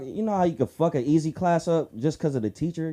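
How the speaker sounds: normal talking voice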